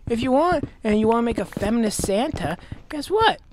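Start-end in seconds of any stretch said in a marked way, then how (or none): none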